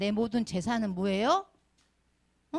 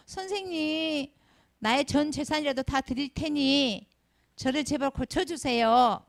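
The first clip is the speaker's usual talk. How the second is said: slow, higher acted plea to a doctor; mock desperate begging; desperate pleading; 5 semitones higher, slower than usual. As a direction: beg the doctor in a drawn-out, hammed-up appeal. Acts desperate patient begging doctor.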